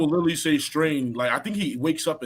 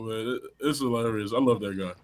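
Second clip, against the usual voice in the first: normal talking voice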